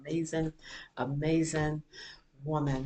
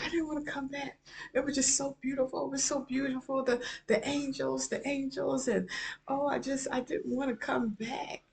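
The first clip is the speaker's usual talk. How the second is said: put-on higher, pleading little-girl voice; little girl's voice; 9 semitones higher than usual. Mother retelling her tearful four-year-old, higher pleading child voice.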